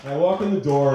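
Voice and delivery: ordinary talk, nothing marked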